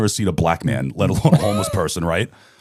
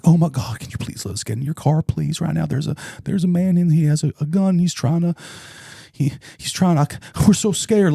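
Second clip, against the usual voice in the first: high, pleading mock panic; the kids beg to get in the car, mock frightened and high; imitating terrified kids; 7 semitones higher than usual. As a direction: play the scared kid begging to get in the car. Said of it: "they were terrified".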